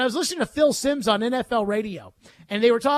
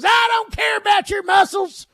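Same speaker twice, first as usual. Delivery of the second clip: higher, louder angry coach shout; coach yelling; 9 semitones higher, 6 dB louder than usual. Retold or imitated. Old football coach yelling at flexing players, loud shout. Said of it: "yells at the guys who are flexing and goes".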